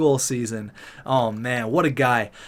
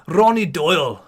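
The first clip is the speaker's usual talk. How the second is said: slightly louder, poorly faked Scottish accent; bad Scottish accent; 2 semitones higher, 4 dB louder than usual. Gamer imagining a tough dude, bad Scottish accent. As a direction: put on a hammy Scottish accent. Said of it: "that's not a good Scottish accent".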